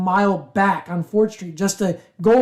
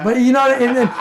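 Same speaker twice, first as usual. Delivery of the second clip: ordinary delivery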